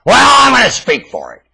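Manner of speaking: high and loud; loud declaration. Real, not acted. Ranter declares he will speak for Bush, loud cry. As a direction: proclaim it defiantly.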